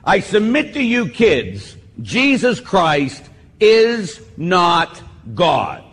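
slow, deliberate challenge; provocative claim. Retold or imitated. Priest provoking sleepy high schoolers, slow and bold. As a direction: drop it like a bombshell, slowly. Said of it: "I say".

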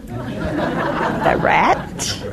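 breathy laughing echo; amused incredulous echo; laughing surprise. Retold or imitated. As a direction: laugh it out in disbelief. Preacher's retold surprised reply.

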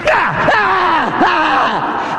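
three high shouted cries; imitated attack yells. Centurion running up to strike, high attack yells. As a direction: yell out with each lash. Next soldier runs up striking.

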